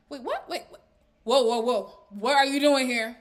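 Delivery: mock-startled string of questions; taken aback. Zari to the man arriving unannounced, mock taken aback. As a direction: halt an unexpected visitor, acted out. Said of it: "she's just like, "Why would he come unannounced".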